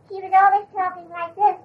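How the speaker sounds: high little schoolboy voice; Tommy's voice. Shown as a demonstration. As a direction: pitch it up like a bright little boy. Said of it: "she would even change her voice".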